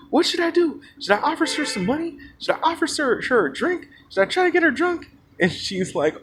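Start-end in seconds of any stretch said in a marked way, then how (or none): 0.1-5.1 high-pitched, insistent whiny imitation; mock him in a high, droning complaint; mock obnoxious guy. Guy complaining about women across the table, high obnoxious mock voice. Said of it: "this guy on the loud ass other side".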